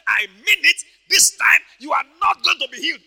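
loud, emphatic devil's threat; acted insistent shout. Devil threatening Papa Hagin, acted insistent shout. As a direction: play the devil doubling down at full volume.

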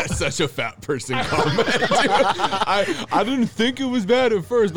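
jab delivered through real laughter; laughing tease. Real, not acted. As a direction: crack up while teasing. Friend cracks up at own jab.

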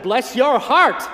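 loud, slow, emphatic put-down; emphatic Southern dismissal; pointed bless your heart. Acted. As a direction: lean hard on it. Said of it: "Boy," I said".

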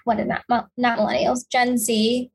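ordinary talk

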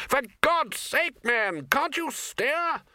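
very high, drawn-out cry; exasperated yell. A narrator's reading. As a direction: yell at a hopeless driver. Exasperated flyer yells while steering badly.

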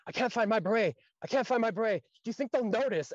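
high, loud frantic pleading; a recruit in a mild mock panic over a missing beret; panicking recruit. Recruit asking a friend about his lost beret, mock panic. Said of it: "he goes".

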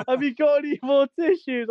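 high pleading mock yell; imitating a mate begging for tissues; mock scream. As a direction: squeal it like a desperate friend in the bushes. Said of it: "he's there screaming to me".

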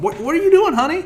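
high, loud, startled wife; shocked wife voice. Acted. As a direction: catch him in the act, shrill with shock. Voices the wife coming home.

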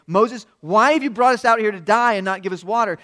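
loud, high crowd complaint; acting out an aggrieved mob; angry accusation. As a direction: go at the leader with a demand. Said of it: "they're gonna get at Moses and say".

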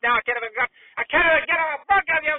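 building from loud anger to high-pitched screaming; furious meltdown. Acted. Fired uncle blaming his nephew, screaming fury. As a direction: completely lose it, voice climbing.

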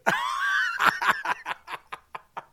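high, wheezing laugh; laughing hard. Real, not acted. Laughing at his dad's accent, high helpless laugh. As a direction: crack up helplessly at your own story. Cracks up at his dad story.

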